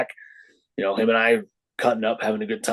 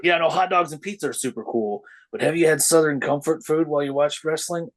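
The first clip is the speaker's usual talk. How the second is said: higher, eager pitch voice; mock sales pitch; 6 semitones higher than usual. To the host, pitching Southern comfort food at wrestling, eager and higher.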